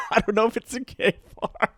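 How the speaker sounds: blurting the answer through real laughter; laughing answer. Real, not acted. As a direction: laugh it out, you can't hold it in. Laughs while answering the question.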